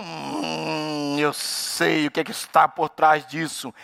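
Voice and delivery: low, slow, knowing hum and verdict; mock all-knowing suspicion; suspicious judging. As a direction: draw it out as if seeing through someone. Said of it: "Quantas vezes você vê a ação do irmão?".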